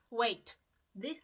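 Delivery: normal talking voice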